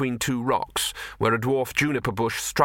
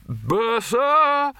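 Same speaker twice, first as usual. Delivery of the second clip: high, loud summons down the corridor; Arch-Chancellor hollering for his bursar; calling out; 12 semitones higher, 3 dB louder than usual. Acted. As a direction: bellow for the servant.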